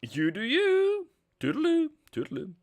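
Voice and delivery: high, playful sing-song farewell; silly high goodbye. Pretend. Waving off the chat in a high sing-song voice. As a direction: chirp it like a cartoon.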